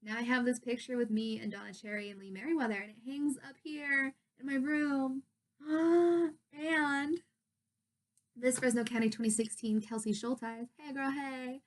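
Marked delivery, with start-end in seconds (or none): 5.6-6.3 high, loud intake of breath; excited gasp. Real, not acted. Showing off her picture with the stars, excited gasp. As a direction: gasp with delight.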